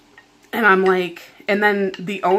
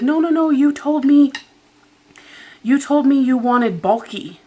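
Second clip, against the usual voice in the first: higher, louder mock insistence from the nail tech; imitating the nail tech; 6 semitones higher, 4 dB louder than usual. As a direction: play the pushy nail tech, pitched up and loud. Nail tech's retold insistence.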